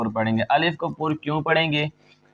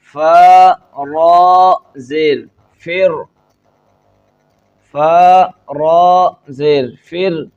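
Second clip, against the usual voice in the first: spelling recitation; 4 semitones higher, 9 dB louder, much slower than usual. Shown as a demonstration. Teacher spells letters aloud.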